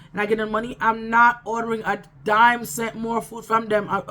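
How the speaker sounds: ordinary delivery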